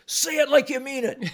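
high mock yell, pushed up hard; retold yell. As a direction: bark the challenge up high. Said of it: "I yelled at him".

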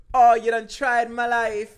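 high, loud, drawn-out Jamaican-accent imitation; mock Jamaican accent. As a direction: imitate an affronted Jamaican woman, slow and loud. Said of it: "the Jamaican accent".

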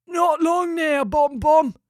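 breathless, high pelican gasp. Acted. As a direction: push the words out on a gasp. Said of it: "gasped Gary".

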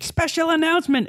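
announcing big news. Acted. Hyping the announcement.

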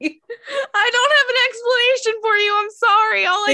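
high helpless laughter; laughing. Real, not acted. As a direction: laugh through the apology. Laughing apology.

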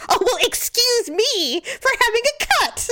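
high, whiny mock retort; indignant sarcastic imitation. Husband snaps back when wife dismisses injury, indignant sarcastic imitation.

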